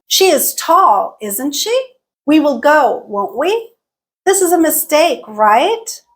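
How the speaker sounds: each tag, ISN'T SHE, WON'T WE, RIGHT, swept upward; upturned tags seeking agreement; rising tag questions. Shown as a demonstration. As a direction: make the statement, then raise ISN'T SHE, WON'T WE, RIGHT. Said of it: "they all had rising intonation".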